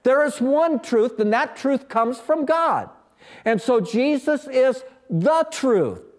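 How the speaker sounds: usual speaking voice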